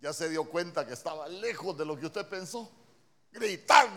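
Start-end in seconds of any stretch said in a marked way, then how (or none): 3.3-4.0 loud, high burst of mistaken certainty; mock crowd shout. Preacher mimics everyone guessing what tone means, mock shout. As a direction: blurt it out loudly as the crowd's answer. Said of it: "dijo todo el mundo".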